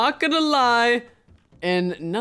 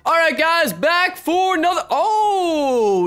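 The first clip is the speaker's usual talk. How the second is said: loud, high round intro bursting into delight; excited shout; 9 semitones higher, 7 dB louder, much slower than usual. Real, not acted. Gamer back for another round, excited shout. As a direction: announce it big, then a long thrilled "oh". Opens round, spots sheriff role.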